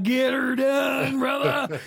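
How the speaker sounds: Larry the Cable Guy voice. Retold or imitated. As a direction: holler it slow like a good-ol'-boy comic. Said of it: "And she be like".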